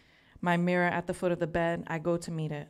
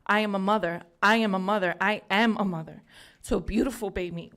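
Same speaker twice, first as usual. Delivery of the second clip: louder, firm declaration; with force; 6 dB louder than usual. Real, not acted. Poet to the mirror, firmly declaring she is a mother. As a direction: say it like a vow you mean. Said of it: "I say it with force".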